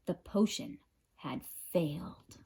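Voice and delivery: hushed narrator whisper. A narrator's reading. Narrator tells listeners the plan failed, whispered. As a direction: drop to a breathless whisper.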